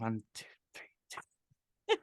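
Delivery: hushed whispered counting under the breath; whispering counts. Retold or imitated. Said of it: "Literally whispering".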